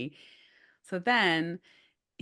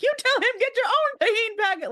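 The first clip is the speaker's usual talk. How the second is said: much higher, loud indignant yell; outraged yell; 5 dB louder than usual. Retold or imitated. Big brother Dominic telling Gio to stand up, loud high yell. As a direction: shout the comeback in mock outrage.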